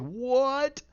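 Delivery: loud, high rising disbelief; mock astonishment. Viewer reacting to the reveal, loud mock astonishment. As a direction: shoot the pitch way up like a shocked viewer. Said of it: "You're like".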